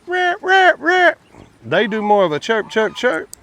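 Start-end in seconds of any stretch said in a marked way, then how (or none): 0.1-1.1 imitated male quail call. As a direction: squawk it out like a crowing male quail. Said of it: "A, a male quail would be like".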